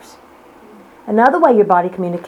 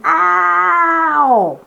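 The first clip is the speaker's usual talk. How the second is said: a trapped body begging to be let out; mock scream, drawn out and high; body screaming for help; 5 semitones higher, much slower than usual. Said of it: "it's screaming".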